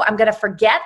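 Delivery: normal talking voice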